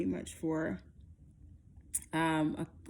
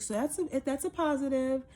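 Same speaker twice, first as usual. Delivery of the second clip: higher-pitched, tentative imitation; muted nurse delivery; 8 semitones higher than usual. Nurse confirming positive test, understated. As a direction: deliver good news without the fanfare. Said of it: "that it wasn't like".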